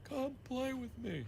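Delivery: hushed, drawn-out mock ghost-child invitation; creepy twin. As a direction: lightly play a haunted ghost child. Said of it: "a pair of twins pops out and say".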